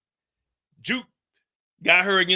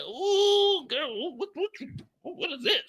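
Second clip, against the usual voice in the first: high, trailing-off fluster; a lightly voiced flustered woman; 9 semitones higher, 5 dB quieter than usual. Juke flustered by the girl, high and soft.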